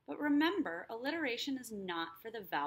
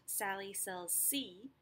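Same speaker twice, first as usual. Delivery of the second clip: slow, leaning on the S in "Sally sells sea"; stressed S sounds; 3 dB quieter, slower than usual. Shown as a demonstration. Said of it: "the s sound".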